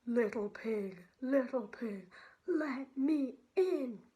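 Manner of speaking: wolf demanding to be let in; wolf calling. Acted. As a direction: boom it at the door. Wolf calls at door.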